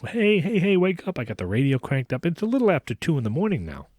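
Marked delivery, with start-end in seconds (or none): 0.1-1.2 calling out. Retold or imitated. Driver banging on roof, waking passenger.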